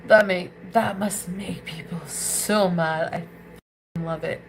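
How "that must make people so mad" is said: normal talking voice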